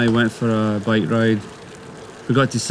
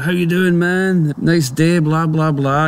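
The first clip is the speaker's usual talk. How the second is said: chatty stranger; 6 semitones higher, slower than usual. Retold or imitated. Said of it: "He's like".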